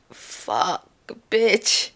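pained curse. Retold or imitated. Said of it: "I cursed them out".

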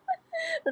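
short high, soft laugh. Real, not acted. Singer jokes about a rehearsal round, soft giggle. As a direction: let out a small high giggle.

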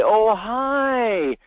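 high, drawn-out salesperson "oh, hi"; mock sales greeting. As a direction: imitate a sales rep's overdone hello. Mimicking typical sales reps' greeting.

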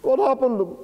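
pitched high with dread; desperate to know; preacher voicing the grieving mother; anguished high question. Mother asks after news.

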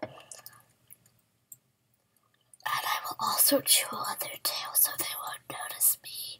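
hushed sneaky whisper. Pretend. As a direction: whisper like a sneaky game character.

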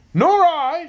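high, loud shout. A narrator's reading. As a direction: officers shout together. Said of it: "shouted each of the other officers".

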